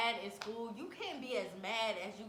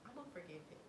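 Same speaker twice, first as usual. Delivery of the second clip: much softer, almost under the breath; sheepish low admission; 3 semitones lower, 13 dB quieter, much faster than usual. Retold or imitated. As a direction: drop the volume right down to admit it. Sheepishly admits forgiving the boyfriend.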